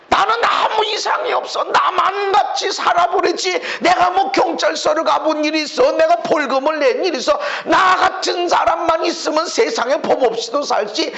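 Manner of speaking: mock proud boaster; self-righteous boast. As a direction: puff yourself up and mock the idea of sin. Voicing a self-righteous person.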